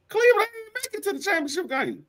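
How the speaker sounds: high-pitched, incredulous retort; incredulous outburst. Real, not acted. Sports fan rebutting a friend about Cleveland, high incredulous protest. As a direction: let the voice leap right up in disbelief.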